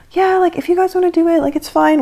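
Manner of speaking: small, high, quick, soft voice; sheepish. Retold or imitated. Excited passengers hiding it from the group, sheepishly. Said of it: "kinda being a little sheepish".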